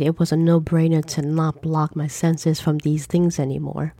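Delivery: ordinary delivery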